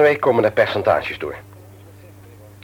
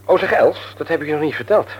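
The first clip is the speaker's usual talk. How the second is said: normal talking voice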